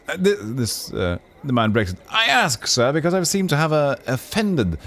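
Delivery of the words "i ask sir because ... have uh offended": high, eager pleading courtier voice; fussy noble. Acted. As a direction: rush up and beg for attention, pitched high.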